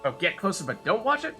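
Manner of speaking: normal talking voice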